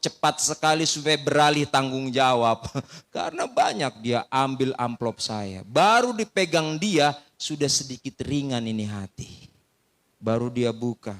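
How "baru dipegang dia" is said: normal talking voice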